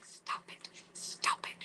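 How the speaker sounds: sharp-edged whispered scolding; mom whisper. Retold or imitated. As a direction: whisper it like a mom quietly correcting a kid. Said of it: "the mom whisper as well".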